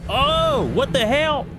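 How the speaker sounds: sudden high startled cry; surprised. Real, not acted. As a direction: caught off guard, yelp it out.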